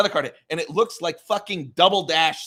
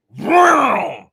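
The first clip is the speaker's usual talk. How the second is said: loud, higher engine noise; engine vroom; 10 semitones higher, 9 dB louder than usual. Retold or imitated. Describing the kart flying, loud vroom. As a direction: imitate a kart roaring past.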